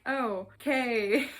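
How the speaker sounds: slow, mock-accepting okay with amusement; baffled reaction. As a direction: react to something silly, stretching the words out. Mimics her baffled reaction to silly line.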